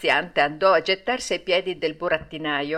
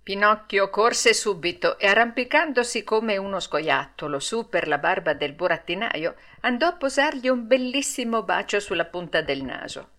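ordinary delivery

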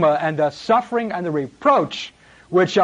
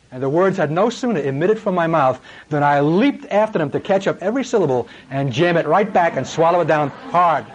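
ordinary talk, nothing marked

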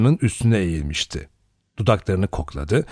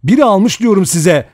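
high, loud, insistent shout; loud, high-pitched protest; 10 semitones higher, 8 dB louder than usual. A narrator's reading. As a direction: shout it to make them believe you. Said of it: "Armstrong bağırıyordu".

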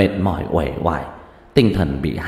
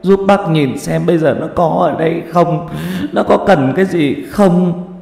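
higher, louder pleading with a choked cry; a grieving mother begging the boy to look for her daughter; tearful begging; 8 semitones higher, 4 dB louder than usual. Acted. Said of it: "mà lạy lục".

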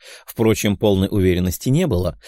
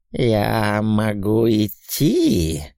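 slow, syrupy demon hiss; sugary hiss; much slower than usual. Acted. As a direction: hiss it sweetly, like a sly demon. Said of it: "слащаво прошипел демон".

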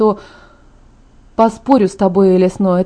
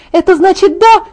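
high, loud hopeful question; thrilled; 10 semitones higher, 5 dB louder than usual. A narrator's reading. Friend hears she may organize the wedding, thrilled. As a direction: leap up in pitch with delight. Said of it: "Её глаза просияли".

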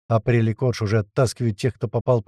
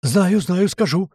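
higher, frightened croak; hoarse croak; 5 semitones higher, 3 dB louder, slower than usual. A narrator's reading. Terrified old man gives in to threats, croaking hoarsely. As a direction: croak out a hasty surrender. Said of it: "прохрипел дед".

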